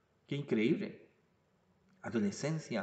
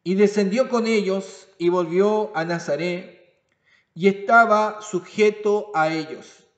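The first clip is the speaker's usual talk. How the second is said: scripture reading; 6 semitones higher, 10 dB louder than usual. Acted. Reads scripture aloud.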